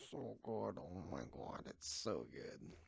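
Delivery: soft, slow breathy delight; savouring. Real, not acted. Candy lover savouring Reese's, soft and slow. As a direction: melt over the chocolate.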